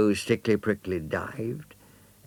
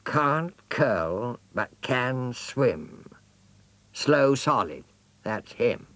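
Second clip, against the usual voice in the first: singsong, slow rote recitation; jaguar reciting; 6 semitones higher, 3 dB louder, slower than usual. Acted. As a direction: drill the rule into memory.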